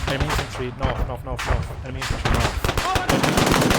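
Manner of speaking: urgent callout; calling out. Real, not acted. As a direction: call out the enemy position urgently. Calling out enemy contact.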